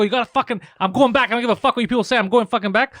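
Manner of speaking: hammed-up defiant yelling at heaven; dead man demanding heaven send him back, in a mock furious yell; retold angry outburst. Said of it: "I started to get so angry".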